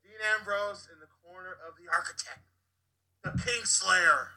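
high-pitched dramatic introduction; announcer. Pretend. Podcaster introduces Ambrose's return, hyped announcer voice. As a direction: announce the return high, drawing out the last title.

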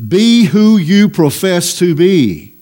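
slow, firm emphasis on each word of "Be who you profess to be"; preacher's slow, emphatic charge. Retold or imitated. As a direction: drive home "Be who you profess to be" slowly and forcefully. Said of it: "he's saying very emphatically".

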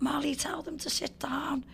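quick imitation of an Australian voice; lightly voiced Australian woman calling out; Australian accent. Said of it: "in a little Australian accent".

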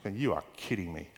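low, hushed disbelief, acted out; incredulous mutter. Churchgoer balking at God's order to shout, incredulous mutter. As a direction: voice a reluctant man's quiet protest. Reluctant man answers God's command.

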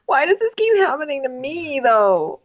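high mock self-pitying whine; Colton. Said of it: "He's like".

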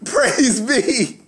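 high, drawn-out hallelujah; mock-reverent high exclamation; mock praise. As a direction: stretch it high like a grateful churchgoer. Exclaims mock thanks on hearing name.

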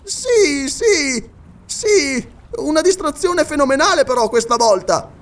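a scholar beside himself over his blunder; high excited scholar, near tears; agitated Paganel. Acted.

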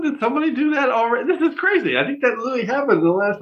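high, fast, amazed rush; suddenly astonished and talking fast; excited disbelief. Real, not acted. Stunned at repeated sign-off.

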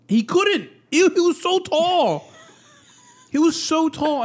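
high, drawn-out exclamation; high-pitched, emphatic disbelief; emphatic amazement. Real, not acted. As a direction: insist on it, voice shooting way up. Stressing the man's height to friend.

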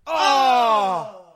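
loud, high drawn-out "oh"; shocked shout. Real, not acted. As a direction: cry out at a near fall. Near fall in wrestling commentary.

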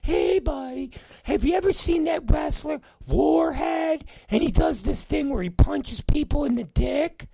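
high, eager falsetto impression; mock him pitching a dumb idea in a squeaky voice; Brandon. Coworker pitching a wrestler to his boss, high mocking falsetto. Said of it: "Sometimes he even has, like, talent ideas".

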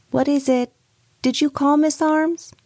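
high, voiced older lady calling up; calling from downstairs. A narrator's reading. Miss Bird calls up stairs.

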